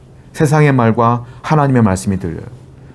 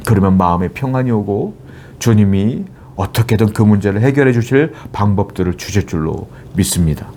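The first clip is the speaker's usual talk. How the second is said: ordinary talk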